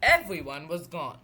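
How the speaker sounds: ordinary delivery, nothing marked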